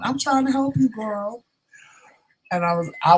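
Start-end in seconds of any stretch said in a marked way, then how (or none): 0.0-1.4 high, campy protest. Acted. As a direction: plead your case in a high voice. Coworker pleads he is helping.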